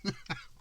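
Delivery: high, unvoiced snort of laughter; a laugh that bursts out; breathless giggle. Real, not acted. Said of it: "I'm over my laughing fit".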